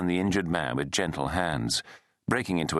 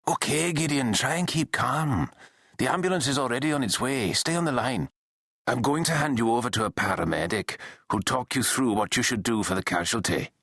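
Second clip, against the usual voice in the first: higher-pitched Scottish operator; steady a caller in broad Scots; broad Scottish accent; 6 semitones higher than usual. A narrator's reading. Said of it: "said in a broad Scottish accent".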